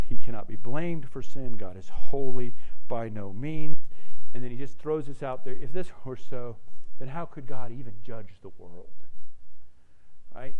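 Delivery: normal talking voice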